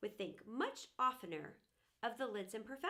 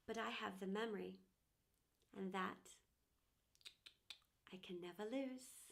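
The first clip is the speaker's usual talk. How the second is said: soft, low, lingering close; tender wistfulness; 5 semitones lower, 6 dB quieter than usual. Acted. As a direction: let it sink gently with long pauses. Broken teapot's closing cherished memory.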